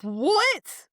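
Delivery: high, drawn-out "what"; incredulous high squawk; mock disbelief. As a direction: imitate someone scandalised by the answer. Retold disbelief at stair count.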